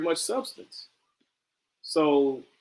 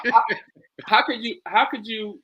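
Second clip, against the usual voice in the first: ordinary delivery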